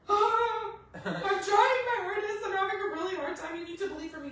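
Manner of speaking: tearful pleading. Retold or imitated. She begs her husband to believe for her, tearful pleading. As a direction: beg through tears for help.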